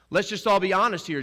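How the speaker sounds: loud, high challenge to the accusers; confronting the court. Retold or imitated. Jesus calling out the court, loud and pointed.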